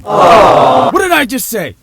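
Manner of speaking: high, loud, slow comic outburst; drawn-out exasperated shout; exasperated yell. Acted. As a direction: yell it in mock frustration. Comic snap at his own stumble.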